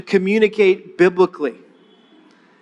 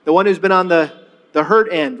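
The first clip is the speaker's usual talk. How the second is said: ordinary talk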